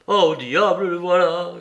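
loud alarmed outburst; a loud, startled curse; cry out. A narrator's reading. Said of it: "cria Heathcliff".

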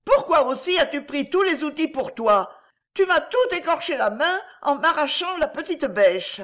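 put-on high, indignant child's retort; high-pitched childish complaint; quarrelling child. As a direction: throw the blame back like a wronged kid. Children's quarrel, hurling blame back.

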